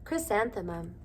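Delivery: ordinary delivery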